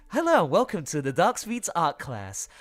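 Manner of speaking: high, loud put-on announcer asking for cash; mock host voice. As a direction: ham up a greedy teacher welcoming class. Mock art teacher greets, asks money.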